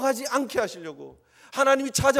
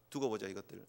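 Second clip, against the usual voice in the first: low, quiet grudging mutter; muttered threat; 13 semitones lower, 12 dB quieter than usual. Retold or imitated. Pastor imagining God's grudge, quiet threatening mutter. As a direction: mutter it under your breath like a sulking god. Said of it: "이럴 것 같아요".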